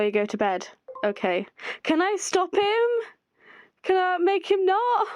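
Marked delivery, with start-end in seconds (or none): 1.8-5.1 much higher, pleading worry; small, worried character voice; anxious high voice. Acted. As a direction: fret over him in a high voice.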